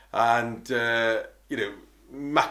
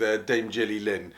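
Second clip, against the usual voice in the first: ordinary talk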